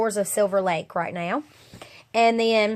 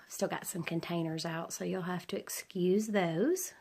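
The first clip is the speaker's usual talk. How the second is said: whispering; 9 dB quieter than usual. Real, not acted. Aside about messy containers.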